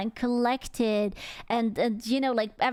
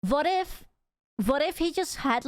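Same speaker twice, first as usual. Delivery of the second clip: ordinary talk, nothing marked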